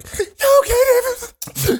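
high drawn-out whine; whiny high. Retold or imitated. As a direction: stretch it out, high and sulky. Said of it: "And I'm like".

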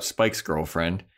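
ordinary talk, nothing marked

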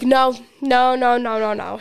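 imitating dad's emphatic string of noes; loud first, rattled-off refusal; retold firm refusal. Dad refusing painkillers for the kid, retold firm refusal.

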